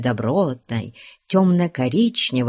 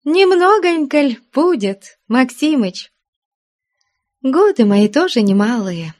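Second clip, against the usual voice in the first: put-on smiling old woman; 9 semitones higher, 4 dB louder than usual. A narrator's reading. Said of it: "улыбаясь, ответила".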